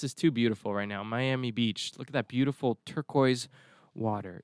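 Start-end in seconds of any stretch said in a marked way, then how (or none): none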